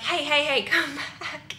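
a man's friendly shout, lightly imitated; mock call-out. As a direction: hail her back across the street, playfully. Guy at bar calling after her.

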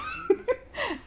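high-pitched nervous laughter. Real, not acted. Player with stomach in knots, nervous high laugh. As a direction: let out a high laugh from nerves.

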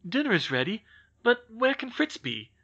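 a little anxious. Acted. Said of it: "she continued a little anxiously".

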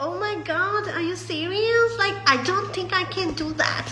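high-pitched, mock fake accent; fake English accent. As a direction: exaggerate a put-on English accent, drawn out and high. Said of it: "මේ fake accent දෙන්න යන්න එපා".

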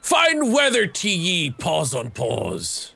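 slow hammed-up pirate greeting. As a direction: drawl it like an old sea pirate. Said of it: "you have to use the voice".

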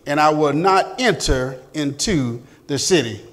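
firm, emphatic Bible reading; scripture reading. Acted. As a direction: proclaim the verse to the class. Reads scripture aloud.

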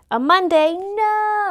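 high loud dismayed "no"; dismayed cry. Real, not acted. Dismayed birthday discovery.

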